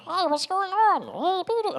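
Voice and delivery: very high, squeaky put-on voice; playful high-pitched cartoon greeting; goofy character voice. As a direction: mess around in a silly high falsetto. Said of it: "Like, I'm messing around".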